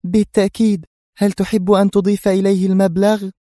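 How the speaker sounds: high, cool feminine voice; composed woman. A narrator's reading. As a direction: offer smoothly in a high-pitched voice. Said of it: "غمغمت وهي تشعل سيجارتها في هدوء".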